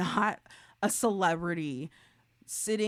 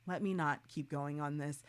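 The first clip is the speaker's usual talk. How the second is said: ordinary delivery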